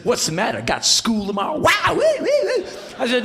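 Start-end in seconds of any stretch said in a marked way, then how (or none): 0.0-1.6 higher, teasing rock-star voice. Retold or imitated. Rock singer teasing a non-drinker, high taunting voice. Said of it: "And [name] said". 1.6-2.7 high, loud yelping whoops; rock-singer whoops. Retold or imitated. Rock singer punctuating his own tease, high loud whoops.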